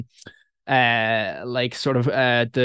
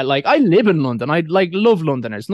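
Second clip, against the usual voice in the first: normal talking voice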